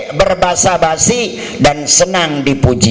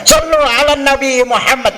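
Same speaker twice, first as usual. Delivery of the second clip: higher call to bless the Prophet; raised voice breaking up a quarrel; call for salawat; 8 semitones higher than usual. Retold or imitated. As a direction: cry it out to calm the fight. Calling to stop a quarrel.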